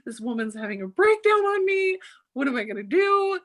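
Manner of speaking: high-pitched mock panic; hammed-up, overwhelmed freak-out. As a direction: retell a frantic meltdown, pitch way up. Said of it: "freaking out".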